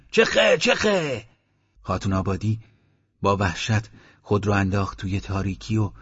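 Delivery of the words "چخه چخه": slow, drawn-out roar pitched very high. Acted. The men leap forward roaring at the old dog, a slow high bellow. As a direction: bellow it to scare the dog into the dark. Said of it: "نعره کشیدند".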